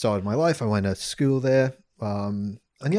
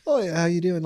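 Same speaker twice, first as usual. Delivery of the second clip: put-on British accent; 7 semitones higher than usual. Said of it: "same thing".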